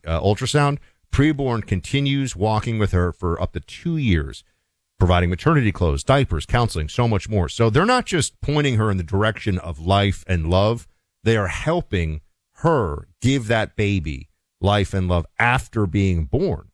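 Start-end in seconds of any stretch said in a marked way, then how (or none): none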